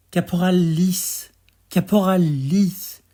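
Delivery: lifted, ringing poetic hail; calling out. Acted. Calling the corporal in a poem.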